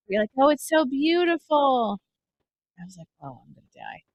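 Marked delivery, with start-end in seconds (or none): 0.4-2.0 high, slow, drawn-out admiration; mock awe. Acting out awed rider. 3.2-4.0 low, hushed doom; quietly acted rider's panic; mock dread. Acting out dread on the ride.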